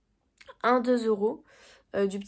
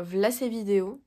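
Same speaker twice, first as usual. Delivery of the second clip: usual speaking voice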